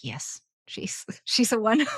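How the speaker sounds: breathless whispered delight, laughing; excited whisper. Retold or imitated. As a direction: whisper it like finding the perfect one. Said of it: "and I was just like".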